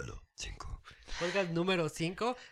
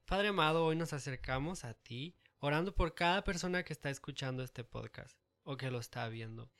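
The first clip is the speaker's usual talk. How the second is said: prayer; 8 dB quieter than usual. Acted. Prayer for listeners.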